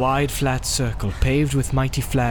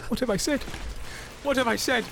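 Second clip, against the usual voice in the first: high, remorseful wail held back; stricken, high-pitched remorse; anguished cry; 11 semitones higher, 6 dB quieter, faster than usual. A narrator's reading. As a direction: cry out in horror at yourself. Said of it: "he cried".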